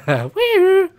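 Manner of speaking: very high, loud victory cry; shrill loud cheer of triumph; gleeful whoop. Real, not acted. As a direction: whoop like you just won. Celebrating a kill.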